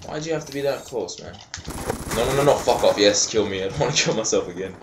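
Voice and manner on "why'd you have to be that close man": softer, quick grumble; subdued complaint. Real, not acted. Gamer repeats his complaint to the enemy, subdued grumble.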